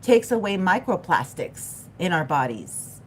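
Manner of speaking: ordinary talk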